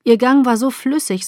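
ordinary delivery

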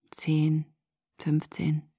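whispered counting of minutes. Acted. Bella counts waiting minutes for Jake's call, whispered. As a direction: breathe the numbers, watching the clock.

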